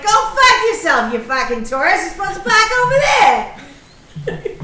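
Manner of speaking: high, loud mock yell at a tourist; angry Gloucester yell. Retelling yelling at a tourist at the mile marker, angry. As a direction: tell off the tourist, Gloucester accent out. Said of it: "that's when I get pissed".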